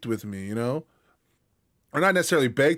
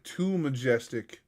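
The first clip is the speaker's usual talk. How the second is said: ordinary talk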